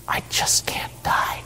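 hushed, unvoiced whisper; barely audible inner whisper. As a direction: whisper it like a voice from deep inside. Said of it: "something inside you says".

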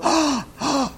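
high, exaggerated gasp of wonder; mock awed gasps. Preacher imitating everybody at the phone, mock amazed gasps. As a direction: gasp as if hugely impressed, hammed up. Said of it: "Everybody going".

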